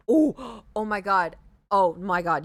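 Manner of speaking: loud gasping oh my God; sudden realization. Real, not acted. Streamer suddenly remembering yesterday, loud and shocked. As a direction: blurt it out as it hits you.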